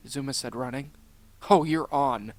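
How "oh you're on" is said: loud, confident acceptance of a challenge. A narrator's reading. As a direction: take up the challenge boldly. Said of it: "Rocky said with confidence".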